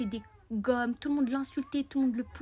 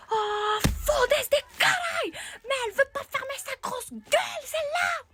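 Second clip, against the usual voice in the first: put-on much higher, louder, slow furious boy; angry boy; 13 semitones higher, 5 dB louder, slower than usual. Boy shouting at the girl, hammed-up rage. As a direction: bellow it like an outraged kid. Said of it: "Il tape sur sa table".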